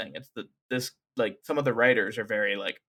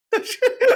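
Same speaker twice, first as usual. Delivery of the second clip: loud squeal of laughter; high sudden laugh; 7 dB louder than usual. Real, not acted. Podcaster caught out by her cohost, squealing high laugh.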